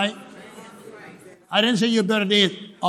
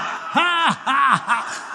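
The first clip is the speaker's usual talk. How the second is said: high forced laugh stepping down; deliberate laugh; 7 semitones higher, much slower than usual. Shown as a demonstration. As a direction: laugh on purpose in separate has. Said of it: "Just laugh on purpose".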